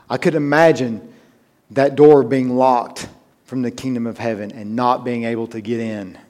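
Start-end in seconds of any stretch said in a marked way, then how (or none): none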